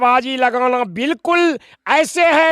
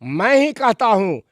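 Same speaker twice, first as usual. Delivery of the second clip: ordinary talk, nothing marked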